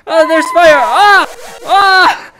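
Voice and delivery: high frantic yelling about fire; panicked shouting. Pretend. Dragon breathing fire, panicking.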